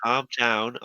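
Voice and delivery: loud calm down. Acted. Calming a quarrel.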